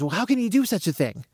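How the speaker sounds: half-hearted imitation of a yelling father; mock scolding dad. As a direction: scold like a shocked parent, without shouting. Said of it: "he yells and he screams".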